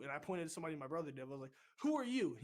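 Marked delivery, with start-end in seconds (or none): 1.8-2.3 high, loud challenge; retold angry demand. Recruit leader pointing at another recruit, loud angry demand. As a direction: re-enact firing the question at the next recruit.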